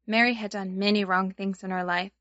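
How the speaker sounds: usual speaking voice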